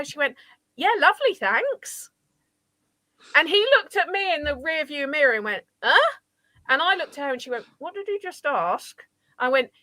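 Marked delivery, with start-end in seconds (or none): 0.7-2.1 high, breezy retold thanks; sing-song politeness; polite bluff. Friend bluffing a polite answer. 5.8-6.2 high-pitched puzzled grunt; sharp questioning "huh"; baffled. Retold or imitated. Driver's puzzled reaction. 7.8-9.0 slow, high, soft question; hushed retold confusion; confused aside.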